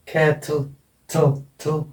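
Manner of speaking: कैटल broken up, then its final थल repeated alone; pronunciation teacher isolating the end of कैटल as थल; syllabic L demo. Shown as a demonstration. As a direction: show the faint schwa before the L in कैटल, थल. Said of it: "इसकी आवाज आए, ईश्वा, श्वा sound".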